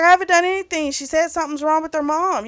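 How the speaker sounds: high, quick mock protest; defensive protest. Accused man answering about the crying girl, retold defensive protest. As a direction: imitate someone hurriedly denying blame.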